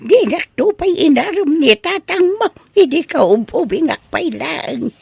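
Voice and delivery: ordinary delivery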